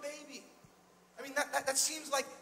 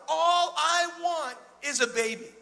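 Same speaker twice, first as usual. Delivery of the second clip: slow, louder mock plea; pleading Abraham; 4 semitones higher, 7 dB louder, slower than usual. Old Abraham begs God for a child, slow mock plea. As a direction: drag it out like a begging old man. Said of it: "He's complaining".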